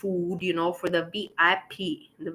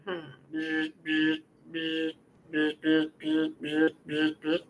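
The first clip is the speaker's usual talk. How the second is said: buzzing; 5 semitones lower, 5 dB quieter, slower than usual. Retold or imitated. Scanning the room for men.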